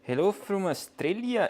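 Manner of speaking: attempted Aussie accent, pitched high; badly faked Australian accent. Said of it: "is that the correct accent".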